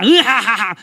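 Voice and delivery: loud mocking belly laugh; hammed-up giant's cackle; Goliath's villain laugh. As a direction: laugh scornfully at the enemy. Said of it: "이렇게 막 웃는 거예요".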